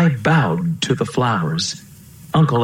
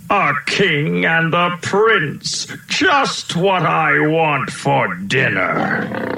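lion's roar; 8 semitones higher, much slower than usual. A narrator's reading.

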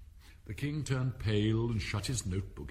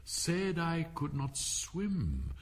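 slow, high, deliberate quotation; quoting verse; 7 semitones higher, much slower than usual. A narrator's reading. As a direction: read the line out slowly, pitched up. King quotes a verse, pondering.

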